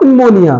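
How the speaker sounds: pneumonia with the P voiced; wrong pronunciation. Shown as a demonstration. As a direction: say pneumonia as "pin-monia". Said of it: "it's not pneumonia".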